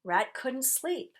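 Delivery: ordinary delivery, nothing marked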